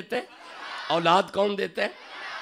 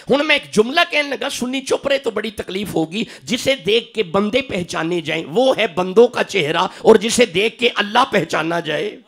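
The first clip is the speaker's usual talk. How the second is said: fiery preaching; 6 semitones higher, 12 dB louder than usual. Acted. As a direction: preach at full force, much louder and higher. Preacher's climax at full force.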